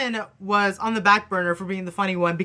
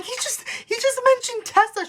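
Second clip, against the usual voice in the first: squealed much higher, racing with fan panic; shrill fangirl freak-out at mom; frantic excitement; 14 semitones higher than usual. Retold or imitated. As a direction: blurt it out like you can't contain yourself. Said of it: "I'm like".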